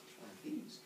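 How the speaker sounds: secretive teasing voice; sly Uranus. Acted. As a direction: hush it right before springing the trick. Uranus teases the trick present.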